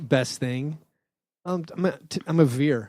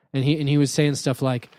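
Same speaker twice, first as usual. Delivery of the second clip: ordinary talk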